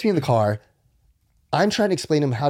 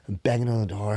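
ordinary talk, nothing marked

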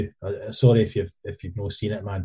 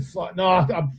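high-pitched mock calling voice; comic put-on voice of a man staying put; imitated bloke calling back; 9 semitones higher than usual. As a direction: call back from behind a toilet door, pitched high. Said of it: "And this boy is like".

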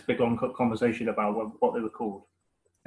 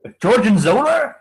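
a broad West Country Gorgonzola, high and loud; mock West Country yokel asking about Gorgonzola; West Country accent; 7 semitones higher, 8 dB louder than usual. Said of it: "forgot that was the West Country accents".

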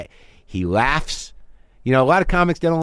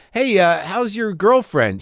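higher, slow polite small talk; fake friendly; 6 semitones higher, slower than usual. Shown as a demonstration. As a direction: fake interest with a bright voice. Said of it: "I was talking to a made-up person".

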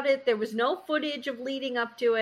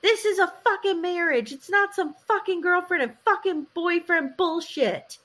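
high, worked-up retelling of a yell; retold yelling; 7 semitones higher than usual. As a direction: imitate a husband yelling in outrage. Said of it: "And he's yelling".

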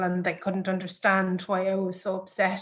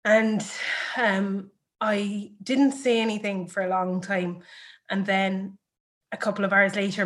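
ordinary talk, nothing marked

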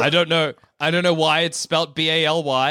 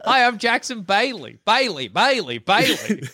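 perky, higher little gnome voice; little gnome accent; 5 semitones higher than usual. Shown as a demonstration. Host shows listeners how Bailey sounds, little gnome voice. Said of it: "That's a little gnome accent".

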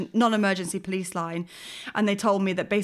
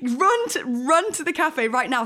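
higher, urgent mock panic; retold urgent plea; 7 semitones higher, 3 dB louder than usual. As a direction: hurry him up. Urging husband to hurry.

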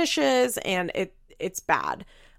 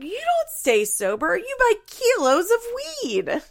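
mock scolding; slower than usual. Retold quarrel, Barbara scolding.

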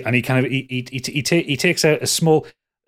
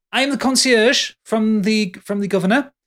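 fussy concierge; 7 semitones higher than usual. Acted. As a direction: play the overeager concierge, lifted and formal. Concierge greets arriving party.

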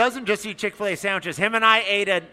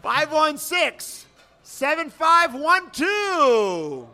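slow, drawn-out number calling; raffle announcer; 4 semitones higher, much slower than usual. Real, not acted. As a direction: call each number out slowly.

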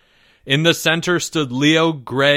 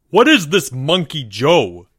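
loud, agitated demand; cried out; 2 semitones higher, 5 dB louder than usual. A narrator's reading. Mister M bursting in on Mister L, cried out. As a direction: cry it out in alarm. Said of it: "he cried out".

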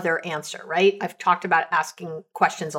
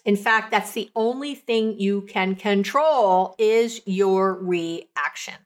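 slow emphasis; slower than usual. Real, not acted. Key takeaway for listeners.

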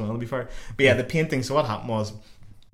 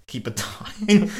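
higher, drawn-out mock kid voice; wee boy; 8 semitones higher, 3 dB louder, much slower than usual. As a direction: imitate a cheeky boy, stretching it out high. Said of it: "the wee son obviously got".

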